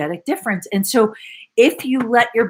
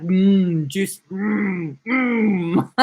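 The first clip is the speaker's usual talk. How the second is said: blissful hum; much slower than usual. Real, not acted. Coach savouring the feeling in her body, humming. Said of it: "feeling it in her body".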